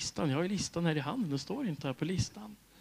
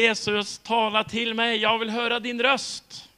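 earnest prayer; 7 semitones higher, 7 dB louder, slower than usual. Retold or imitated. Young man praying in the dark.

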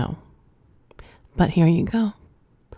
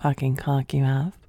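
hushed, teasing bedroom whisper; a quick racy whispered line; sultry whisper; 3 semitones lower, 6 dB quieter than usual. Shown as a demonstration. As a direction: whisper it like a come-on. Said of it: "I may drop it a couple octaves".

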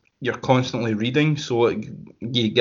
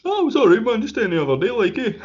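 high casual shrug; 8 semitones higher than usual. Acted. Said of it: "what was it like".

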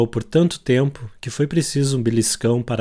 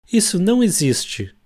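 irritated; 9 semitones higher, 4 dB louder than usual. Acted. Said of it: "Alice declarou irritada".

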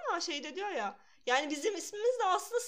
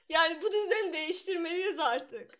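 ordinary talk, nothing marked

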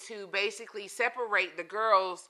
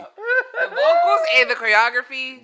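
laughing loudly through words; cracking up mid-sentence; 4 semitones higher, 9 dB louder than usual. Real, not acted. Laughing while describing.

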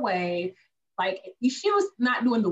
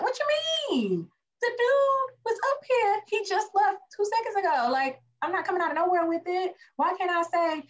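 indignant outburst; 9 semitones higher than usual. Real, not acted. Indignant rant about the scene.

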